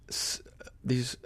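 drops to a near whisper. Real, not acted. Preacher reading hidden thoughts, hushed whisper. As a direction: hush it almost to nothing.

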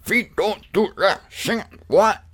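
high-pitched garbled German caricature; mock German accent. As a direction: imitate a German in nonsense syllables. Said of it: "Bader is a German. He's like".

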